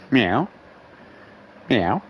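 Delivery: short cat meow flicking up at the end; sassy meow. Retold or imitated. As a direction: imitate a cat with a bit of sass. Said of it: "Little bit of sass".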